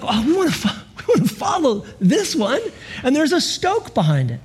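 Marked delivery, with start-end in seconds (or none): 0.0-2.6 higher, laughing eagerness; eager disciples. Pretend. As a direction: giggle with excitement about following. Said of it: "And so, like".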